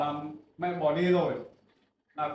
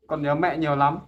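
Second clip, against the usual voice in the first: raised voice, aching and accusing; loud reproach; 8 dB louder than usual. Acted. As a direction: son loudly asking his mother why she left him. Son reproaches mother emotionally.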